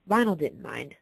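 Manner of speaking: ordinary delivery, nothing marked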